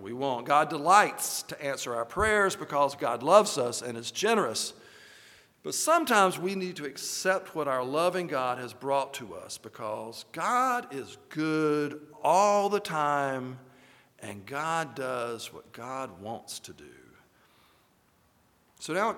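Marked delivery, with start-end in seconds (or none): none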